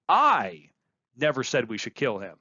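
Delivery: I set apart with a pause and weight; stress on I. Shown as a demonstration. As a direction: lean hard on I. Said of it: "by where you place emphasis".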